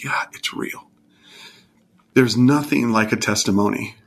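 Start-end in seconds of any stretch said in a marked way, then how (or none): 0.0-0.9 soft, breathy whisper of wonder; awed whisper. Retold or imitated. Telling his weeping son it's real, a hushed whisper. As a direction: whisper it like a sacred secret. Answering Caleb's tearful question.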